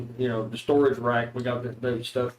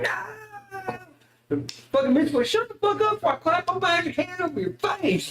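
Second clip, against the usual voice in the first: alternate personality voice. Acted. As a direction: shriek it like a crazed hillbilly. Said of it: "my alternate personality".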